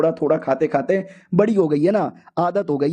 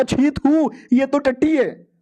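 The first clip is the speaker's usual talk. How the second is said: high, slow disgusted spit-out, pitched up; 6 semitones higher, slower than usual. Retold or imitated. Main character tasting the competition meat, disgusted. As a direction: recoil from vile food, drawing the words out.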